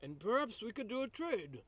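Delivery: soft, high, airy character voice; musing in a delicate put-on voice; hopeful peacemaker. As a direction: float the suggestion gently. Player voices own character.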